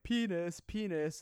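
put-on high, loud kid callout; kids calling out. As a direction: shout it out like a giggling child. Kids shouting.

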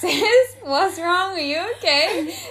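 high, loud, overdone worried voice; mock concern. To her teary friend, overdone high worried calls. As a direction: play up the alarm at her tears. Retelling rushing to crying friend.

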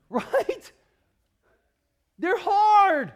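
usual speaking voice

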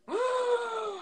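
shocked gasp. Retold or imitated. Guarda chega ao casamento.

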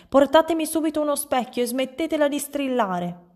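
high, exasperated order; clamouring demand. A narrator's reading. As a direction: order everyone about in a fluster. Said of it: "strepitò il signor Dal Verme". (ordine strepitato, esasperato)

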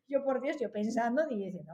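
ordinary delivery

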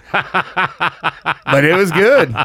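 laughed through, drawn out slowly; laughing. Real, not acted. Host recalling people sleeping in cars, slow laughing. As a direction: chuckle it out slowly. Remembering the night, amused.

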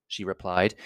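plain reading voice

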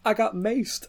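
high-pitched mock woman's voice; high imitation of an interviewee; imitating distressed woman. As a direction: put on a high voice mimicking her. Imitating woman in viral video.

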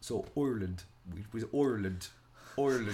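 "Ireland" said as "OY-er-land"; imitated pronunciation. Retelling a viral accent lesson, imitated "Oy-land". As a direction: mimic the coach, opening "Ireland" on an oy sound. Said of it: "we pronounce. So in Ireland".

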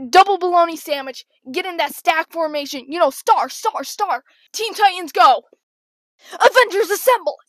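loud, high mock coach's holler; shouted play calls. Coach calling plays to the team, hammed-up shouting. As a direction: bellow the plays across the court. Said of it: "the infamous play is called".

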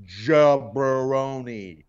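Jabroni stretched out very slowly; drawn-out insult. Acted. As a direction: lean on Jabroni, slow and scornful. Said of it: "Crying Brian is a jabroni".

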